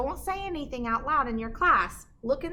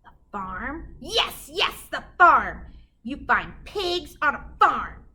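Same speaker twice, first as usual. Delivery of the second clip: rising louder with delight; delighted witch; 3 semitones higher, 7 dB louder, slower than usual. A narrator's reading. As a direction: she finally cracks it, gleeful. Witch realizes.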